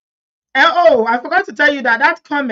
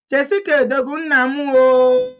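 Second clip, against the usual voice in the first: high, lilting Igbo tune with a long, loud final "oh"; Igbo intonation; 6 semitones higher, 5 dB louder than usual. Shown as a demonstration. Vlogger reads out a fan's Igbo comment, Igbo intonation. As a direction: read it with Igbo intonation, stretching the "oh". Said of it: "Igbo intonation".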